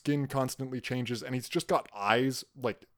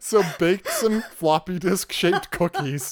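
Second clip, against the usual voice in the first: laughing; 9 semitones higher, 6 dB louder than usual. Real, not acted. Joking about robot cosplay, laughing.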